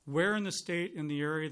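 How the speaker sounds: ordinary talk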